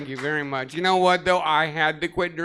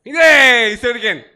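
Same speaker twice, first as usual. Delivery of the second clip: louder, higher, excited shouting; 6 semitones higher, 10 dB louder than usual. Acted. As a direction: yell the guesses out with a laugh. Acting out wheelchair sport guesses.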